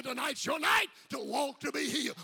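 loud, repeated chant of walk to be healed; shouted refrain. Acted. Preacher to congregation, loud repeated chant. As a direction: drive the refrain home.

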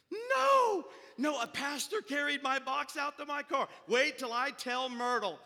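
high shouted disbelief, easing slightly; acted astonishment. Woman learns her helper is a pastor, acted astonishment. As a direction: exclaim in hammed-up amazement.

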